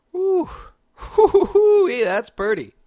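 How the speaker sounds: high, loud joyful woo woo; delighted whoops. Real, not acted. Vlogger gushing over snow-dusted trees, high delighted whoops.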